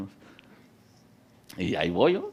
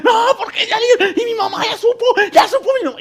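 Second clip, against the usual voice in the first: panicked crying cousin; 9 dB louder than usual. Retold or imitated. Scared jailed cousin pleading.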